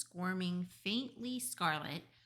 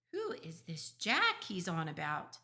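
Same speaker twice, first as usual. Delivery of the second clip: ordinary delivery, nothing marked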